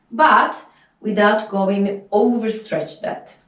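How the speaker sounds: ordinary talk, nothing marked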